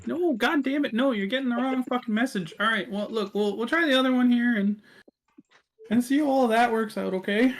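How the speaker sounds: high, scolding character voice; exasperated Death. Acted. Death annoyed they got the wrong message, high exasperated voice. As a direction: pitch it up and rattle off the correction.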